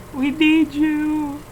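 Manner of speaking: slow, high mock-heroic call; slow dramatic summons; heroic plea. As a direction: summon a hero, drawn out. Calling on Kamen Rider.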